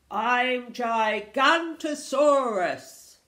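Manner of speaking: huge, slow dinosaur roar; high-volume monster announcement. Acted. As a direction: boom out the name, long and loud. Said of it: "roared the dinosaur".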